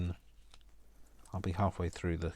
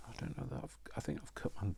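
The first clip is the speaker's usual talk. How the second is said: hushed whisper; 6 dB quieter than usual. Real, not acted. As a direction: whisper it under your breath. Hurt hand, hushed check.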